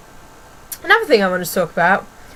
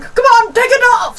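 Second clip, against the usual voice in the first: very high, loud mock impatience; imitated hurrying shout; 6 dB louder than usual. Imitating lady hurrying pupil out of coat, impatient high shout. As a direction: yell it across the room to hurry someone. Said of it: "She's like".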